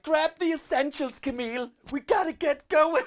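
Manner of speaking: high, loud, panicky mock teen; teenage girl imitation. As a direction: shriek orders like a flustered teenager. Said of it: "these teenage girls".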